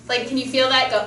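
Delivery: ordinary talk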